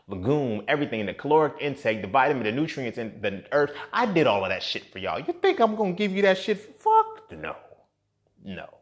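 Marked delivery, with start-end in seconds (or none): 5.2-8.7 high squealed outburst of refusal; scandalized, pitch leaping sky-high; incredulous refusal. Acted. Mock outrage at imagined freebie request.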